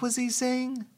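lightly voiced curious woman; wife voice.

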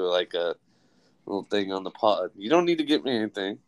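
ordinary talk, nothing marked

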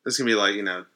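usual speaking voice

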